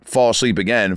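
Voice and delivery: usual speaking voice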